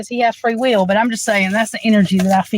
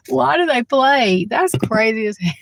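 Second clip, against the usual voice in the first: usual speaking voice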